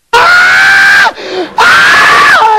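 high mock yell of pain; cry of pain. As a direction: yelp as if each step hurts. Walking the wrong way hurts.

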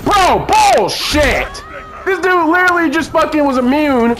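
furious outburst. Real, not acted. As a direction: blow up at the cheap move. Outraged at unfair game move.

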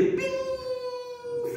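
a high, thin imitated ping; tiny high ping; phone ping. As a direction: chirp it like a message alert.

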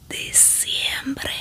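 normal talking voice

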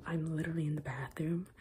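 hushed whisper in a bathroom. Real, not acted. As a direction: whisper so nobody hears. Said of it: "I'm whispering right now".

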